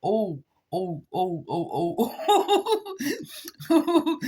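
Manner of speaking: chuckling drawn-out ohs; laughing oh. Retold or imitated. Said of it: "I said".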